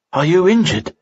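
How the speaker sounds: a knight calling out to his wounded friend, worried cry; cried out. A narrator's reading. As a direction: call out to a friend you feared lost. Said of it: "cried Guy".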